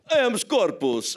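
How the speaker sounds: high, slow, drawn-out character voice; Scorpus impression. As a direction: imitate his theatrical accent, stretching the words. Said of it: "he was doing it in his kind of, his accent".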